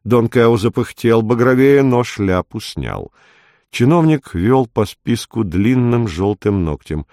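usual speaking voice

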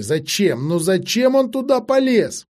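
exasperated plea rising in pitch; rising, distraught why; despairing lament. Acted. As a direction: wail the question, climbing high. Acted despairing lament over the master.

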